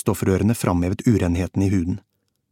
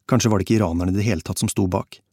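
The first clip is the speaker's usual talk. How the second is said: ordinary delivery, nothing marked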